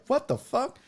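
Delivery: high, shocked disbelief; mock outrage at the drink; incredulous. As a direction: react like you can't believe it. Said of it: "I'm like".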